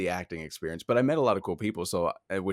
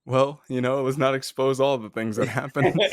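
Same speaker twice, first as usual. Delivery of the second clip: louder, higher, breaking into laughter; laughing protest; 6 semitones higher, 6 dB louder than usual. Real, not acted. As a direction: protest through a real laugh. Joking protest at friend's story.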